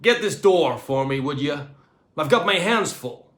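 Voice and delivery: loud brash American request; demanding American astronaut; American accent. A narrator's reading. Astronaut ordering the cleaner, loud American accent. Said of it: "in an American accent".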